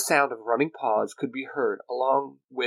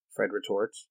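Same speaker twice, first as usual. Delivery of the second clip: plain reading voice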